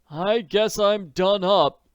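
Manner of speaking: high, slow, exhausted admission; weary admission. A narrator's reading. Jacob Aborn admits exhaustion to Nancy, slow and weary. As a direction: give in, slow and spent. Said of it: "he admitted".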